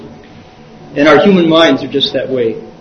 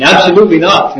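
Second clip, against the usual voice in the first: higher, firm emphatic refusal; emphatic, raised-pitch no; absolute refusal; 6 semitones higher, 4 dB louder than usual. Shown as a demonstration. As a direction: refuse flatly and with force. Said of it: "makes all the difference between".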